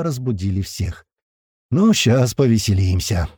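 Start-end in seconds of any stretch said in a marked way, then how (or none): none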